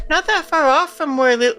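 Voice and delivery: put-on high whiny kid voice; nasal little boy whine. Shown as a demonstration. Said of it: "very nasal".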